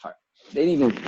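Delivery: usual speaking voice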